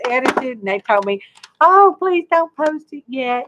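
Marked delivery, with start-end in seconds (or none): 1.6-3.5 lightly voiced higher-pitched plea; retold plea, pitched up; mock pleading. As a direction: imitate someone begging. Retold plea from magazine people.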